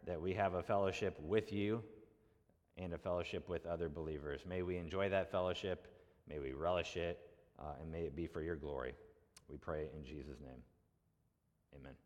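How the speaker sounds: soft, hushed closing prayer. Real, not acted. Pastor prays softly over the congregation, hushed and reverent. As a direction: pray quietly to the Father before teaching.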